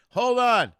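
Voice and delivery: high, loud hold on; loud call. Real, not acted. Audio dropped, calling to Jeff.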